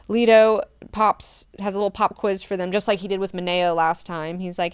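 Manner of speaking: normal talking voice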